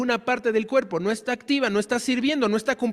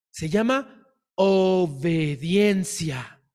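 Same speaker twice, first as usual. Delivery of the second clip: slow emphatic reveal; 3 semitones lower, much slower than usual. Acted. Sermon answer to rhetorical question.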